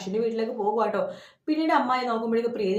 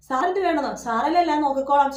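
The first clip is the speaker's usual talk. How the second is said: higher and louder, outraged question; indignant retort; 4 semitones higher, 5 dB louder than usual. Retold or imitated. Sister confronting the boss on the phone, indignant and louder. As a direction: throw his words back at him.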